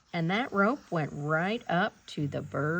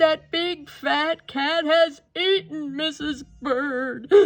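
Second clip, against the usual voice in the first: slow, higher, louder sob-filled wail; Mister Bird weeping over the lost Missus Bird; crying; 7 semitones higher, 4 dB louder, slower than usual. A narrator's reading. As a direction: wail it through tears. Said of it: "he cried".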